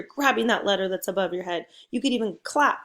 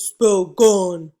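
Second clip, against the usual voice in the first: booming giant voice, much louder; big giant voice; 9 dB louder than usual. Shown as a demonstration. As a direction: boom it out like a big giant for the kids. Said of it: "In a big giant voice".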